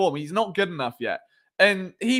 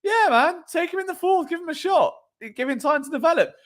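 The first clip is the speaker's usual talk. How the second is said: higher-pitched imagined fan quote; easygoing supporter; 10 semitones higher than usual. Acted. Imagined fan backing a draft pick, easygoing. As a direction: voice a fan urging patience, pitched up. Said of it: "you'd be like".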